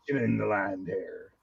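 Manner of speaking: low, drawn-out pirate voice. Pretend. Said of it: "somebody's grandmother sounds like that here".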